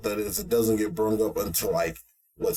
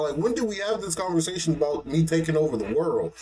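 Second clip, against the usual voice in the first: baffled Ainz; 7 semitones higher, faster than usual. Retold or imitated. Ainz confused voice.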